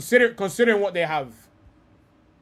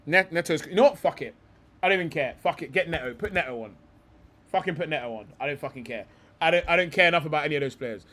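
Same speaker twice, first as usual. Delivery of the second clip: ordinary talk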